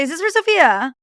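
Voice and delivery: high, loud eager Is this for Sophia?; eager giveaway question. Shown as a demonstration. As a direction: blurt it out too trustingly. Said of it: "Don't say".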